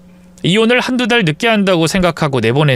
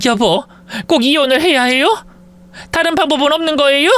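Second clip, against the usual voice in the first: pleading wife; 10 semitones higher than usual. Acted. Wife begs husband not to divorce.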